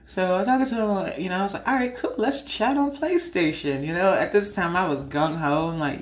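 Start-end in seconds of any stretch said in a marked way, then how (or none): 1.7-3.9 eager reply. Retold or imitated. Eager reply to a gamer guy.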